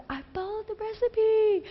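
very high-pitched protesting whine; mock whine. New wife defending her failed cake, mock whiny. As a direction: play up a plaintive, squeaky protest.

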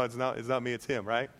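ordinary delivery

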